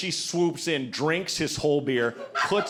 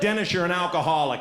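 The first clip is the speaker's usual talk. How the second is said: ordinary talk, nothing marked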